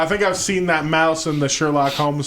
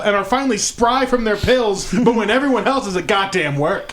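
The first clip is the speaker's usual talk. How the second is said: sarcastic retort; 7 semitones higher than usual. Retold or imitated. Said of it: "I'm like".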